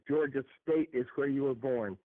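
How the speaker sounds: ordinary talk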